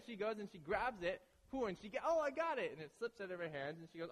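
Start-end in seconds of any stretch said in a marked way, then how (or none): 2.0-2.7 high, loud imitation of her triumph; excited catch. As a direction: squeal it lightly as if you just grabbed it. Acting girlfriend grabbing the fish.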